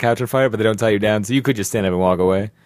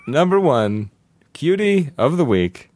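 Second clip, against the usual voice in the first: announcer voice; 5 semitones higher, slower than usual. Pretend. Mock award announcement.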